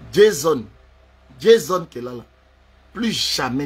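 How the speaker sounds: loud call of the name, falling. Acted. Man addressing his rival, loud call.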